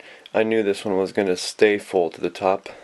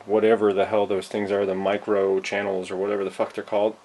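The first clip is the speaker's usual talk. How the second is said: ordinary delivery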